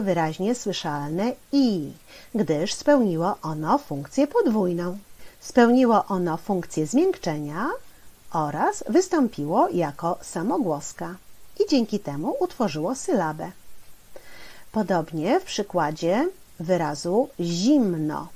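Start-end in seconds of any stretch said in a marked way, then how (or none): none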